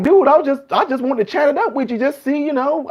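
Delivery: as a confused cop who only wanted to chat; baffled friendly officer. Retold or imitated. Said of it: "the police officer might be like".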